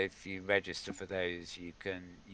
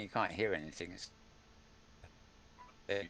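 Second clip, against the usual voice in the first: audio breaking up; 3 semitones lower, 6 dB quieter, faster than usual. Real, not acted. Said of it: "your audio's breaking up".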